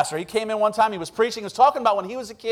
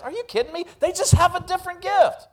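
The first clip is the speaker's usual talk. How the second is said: higher, scoffing disbelief; incredulous; 9 semitones higher than usual. Real, not acted. Preacher scoffing at the church's envy, high and incredulous. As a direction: scoff at the idea.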